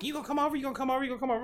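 soft, high put-on nagging; imitating pestering woman. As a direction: pester in a small high-pitched voice, repeating insistently. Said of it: "she was like".

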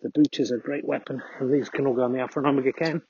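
ordinary talk, nothing marked

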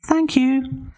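very high pig voice; polite little pig. Acted. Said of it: "said the little pig".

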